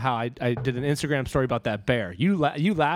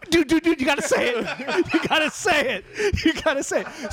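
high, laughing chant of encouragement; egging him on through laughter, pitch up high; excited urging; 12 semitones higher than usual. Retold or imitated. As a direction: friends urging a comic on stage, high and laughing. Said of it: "me and Lou were like".

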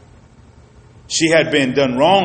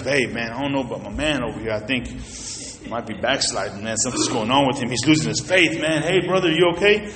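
softer, lower worried aside; worried buddy aside; 5 semitones lower, 6 dB quieter than usual. Retold or imitated. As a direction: murmur concern about a backsliding friend. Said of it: "I'm gonna be like".

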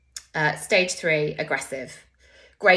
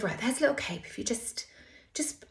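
hushed instructions; 5 semitones higher, 7 dB quieter than usual. Retold or imitated. Nurse instructing quietly in cubicle.

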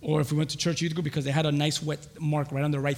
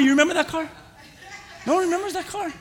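normal talking voice

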